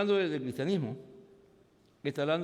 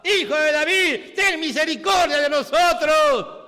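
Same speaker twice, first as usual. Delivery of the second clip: loud high cry for mercy; blind man shouting; 11 semitones higher, 12 dB louder, slower than usual. Retold or imitated. Bartimeo shouts to Jesus, loud desperate cry. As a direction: cry out to Jesus at the top of your voice. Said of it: "Y el otro gritaba".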